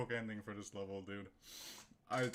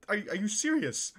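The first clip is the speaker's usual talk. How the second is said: much higher, louder disbelief on serious; incredulous outburst; 10 semitones higher, 8 dB louder than usual. Real, not acted. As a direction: blurt it out, stunned. Stunned by the finish.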